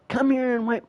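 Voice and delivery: put-on high bratty kid yell; kid yelling at mom. Five-year-old yelling at his mom, high bratty kid voice. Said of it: "Yelling at mom".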